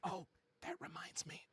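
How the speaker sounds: teasing game-master whisper; whisper in the ear. Acted. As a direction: whisper it right into the player's ear. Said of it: "in your ear, you hear".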